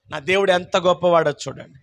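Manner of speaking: ordinary talk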